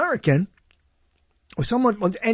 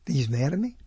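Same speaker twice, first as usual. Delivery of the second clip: normal talking voice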